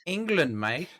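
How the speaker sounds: exaggerated high British accent; hammed-up London voice. Mocking English stereotype.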